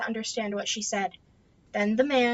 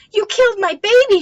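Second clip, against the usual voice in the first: much higher, much louder accusing shriek; hysterical scream; 10 semitones higher, 10 dB louder than usual. Acted. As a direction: scream it at her son's rescuer. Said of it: "she screamed".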